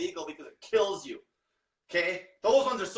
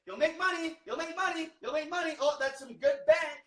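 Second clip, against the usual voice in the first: mock sales pitch; 6 semitones higher than usual. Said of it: "It's like".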